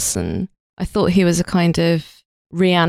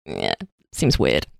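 low, slow, flat mutter; deadpan unimpressed; 5 dB quieter, slower than usual. Retold or imitated. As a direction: shrug it off quietly. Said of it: "then I'm a bit like".

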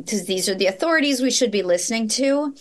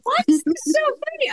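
put-on high kid voice.

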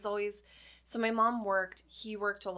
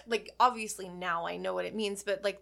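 usual speaking voice

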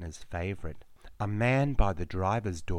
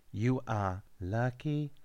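fading repetition; 4 semitones higher, 4 dB quieter than usual. A narrator's reading. Repeated fading lucky.

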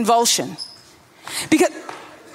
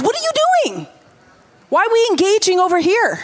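much higher, incredulous rebuke; exasperated Jesus; 5 semitones higher, faster than usual. Retold or imitated. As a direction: call out the disciples in disbelief. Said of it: "he focused that in on".

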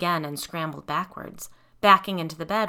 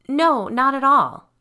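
slow, high, loud soothing denial; placating; 8 semitones higher, 12 dB louder, slower than usual. A narrator's reading. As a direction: placate her, drawn out. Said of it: "her voice irritatingly placating".

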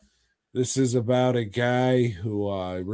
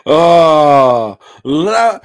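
high, slow, wailing lover's plea; mock lovesick plea; 5 semitones higher, 11 dB louder than usual. The woman calls her lover back, a high, slow hammed-up wail. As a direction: swoon it out like a melodramatic lover.

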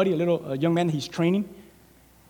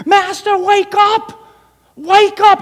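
much higher, louder urgent cry; panicked servant; 13 semitones higher, 8 dB louder, much slower than usual. Acted. Terrified servant waking his master Elisha, urgent cry. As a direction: shake him awake with your voice.

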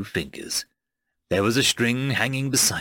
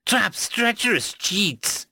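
slow, high-pitched, bitter accusation; spat in contempt; 9 semitones higher, slower than usual. A narrator's reading. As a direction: spit each insult out separately. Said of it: "it spat".

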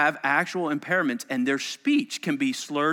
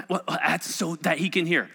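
usual speaking voice

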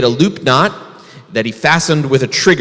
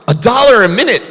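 pitched way up in amazement; surprised bear; 9 semitones higher, 4 dB louder, faster than usual. Acted. Bear amazed at rabbit's pay, high and surprised. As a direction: gasp out the price in disbelief.

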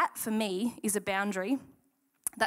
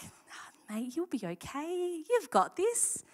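mock coddling pep talk; 8 semitones higher, slower than usual. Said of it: "God didn't be like".

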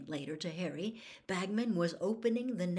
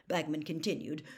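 ordinary delivery, nothing marked

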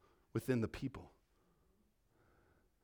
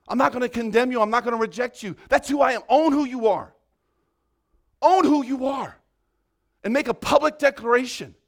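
louder, higher preaching voice, pushing hard; preaching at full force; 5 semitones higher, 10 dB louder than usual. Real, not acted. Pastor preaching to congregation about owning identity, yelling. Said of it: "I've been yelling too much".

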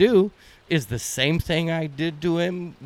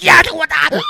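high surprised yell; startled yell; 11 semitones higher, 7 dB louder than usual. Retold or imitated. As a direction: yelp it out, caught off guard. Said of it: "I'm like".

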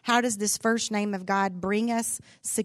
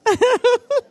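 loud laugh; 12 dB louder than usual. Real, not acted. Congregation laughter erupts.

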